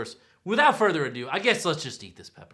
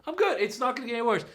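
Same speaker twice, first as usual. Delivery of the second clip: mock confident; 8 semitones higher than usual. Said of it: "I thought".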